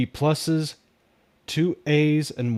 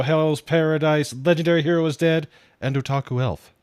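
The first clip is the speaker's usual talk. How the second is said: ordinary talk, nothing marked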